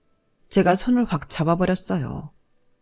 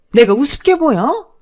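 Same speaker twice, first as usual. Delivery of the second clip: higher and louder furious challenge; indignant elder yelling; angry outburst; 7 semitones higher, 6 dB louder than usual. Acted. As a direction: snap at her like she's been insulted. Mother-in-law scolding the narrator.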